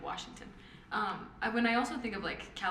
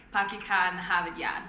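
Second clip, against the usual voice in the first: put-on Boston accent; 5 dB louder than usual. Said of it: "Boston. I always think of Good Will Hunting".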